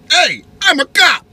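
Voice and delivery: high, loud mock cop; a hammed-up cop announcing himself. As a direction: bark it like a self-important cop. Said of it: "He flips on his lights".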